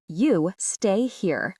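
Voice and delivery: "You... stay... here", very slow with a gap after each word; pausing between words. A narrator's reading. Christine to the foreign student, "You stay here" as though for a deaf person, word by word. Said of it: "pausing between each word".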